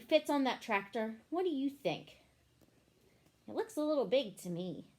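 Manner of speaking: ordinary delivery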